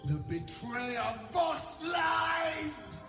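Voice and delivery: slow furious proclamation; angry shout. Acted. Queen denounces traitor.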